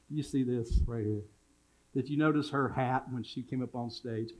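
usual speaking voice

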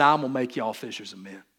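Jesus as a Southern man, in a light Southern accent. Shown as a demonstration. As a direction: give "y'all" an easy Southern twang. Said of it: "if Jesus was a Southern man".

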